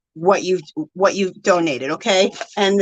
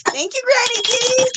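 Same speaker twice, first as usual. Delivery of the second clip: very high, gleeful thank-you; celebratory cheer; 14 semitones higher than usual. Real, not acted. Streamer redoing a donor celebration, celebratory cheer. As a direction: squeal it out for the donor.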